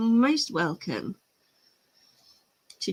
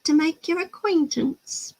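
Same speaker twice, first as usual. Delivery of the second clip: higher, mock-posh British accent; hammed-up British accent, pitched up; 8 semitones higher than usual. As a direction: put on a prim British accent, like an American trying it. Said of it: "sounds like an American doing a British accent".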